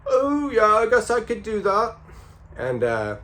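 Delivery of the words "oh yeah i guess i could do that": very high put-on Liverpool accent; exaggerated, high Liverpudlian voice. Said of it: "John Lennon was like".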